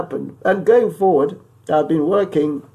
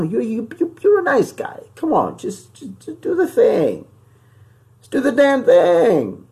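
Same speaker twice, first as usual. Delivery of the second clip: exasperated pleading; 9 semitones higher than usual. Acted. Host appeals to singer [name] to pay up, exasperated pleading. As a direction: plead in a raised voice, drawing out the last words.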